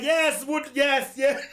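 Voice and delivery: high, loud cheering yeses; acted-out crowd enthusiasm, loud and high; excited approval. Jamaicans' delighted approval acted out.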